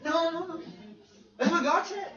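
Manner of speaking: high, mock-indignant reply to a critic; mock retort. Preacher answering an imagined critic, high and mock-indignant. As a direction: play the preacher brushing off a critic.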